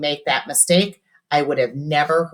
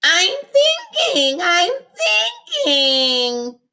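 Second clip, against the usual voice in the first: high, loud nasal whimper; nasal whine; 4 dB louder, much slower than usual. A narrator's reading. Robert under pressure to his friends, nasal whine. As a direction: whine it like a cornered little boy. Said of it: "he whined in his nasal little voice".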